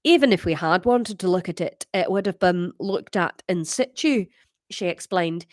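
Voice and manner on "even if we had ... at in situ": put-on lower-pitched Glasgow accent; Scottish character, on the deeper side. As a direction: read it in a deep Scottish voice. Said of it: "the deeper one".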